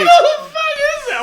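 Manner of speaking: high, loud burst of laughter through words; laughing yell at the interruption; laughing shout. Real, not acted. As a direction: crack up and shout it out. Laughing outburst at friend.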